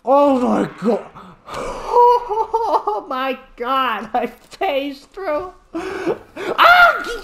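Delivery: high, loud shrieking laugh, slow; giddy panic laughter after a fright; shocked laughter. Real, not acted. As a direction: laugh off a real scare at the top of your voice.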